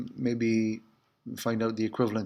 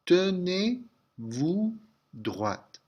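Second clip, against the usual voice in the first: very slow drill; 6 semitones higher, much slower than usual. Shown as a demonstration. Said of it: "stand up straight".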